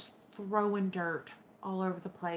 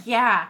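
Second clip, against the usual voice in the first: loud laugh into yeah; burst of laughter; 3 semitones higher, 11 dB louder than usual. Real, not acted. Mom laughing about her husband's same answer. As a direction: laugh out loud then agree.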